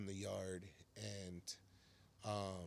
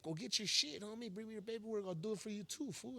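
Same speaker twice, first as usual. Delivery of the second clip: higher, friendly urging voice; lightly voiced self, pitched up; offering help; 11 semitones higher than usual. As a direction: urge your buddy to bring his papers. Narrator voices himself offering.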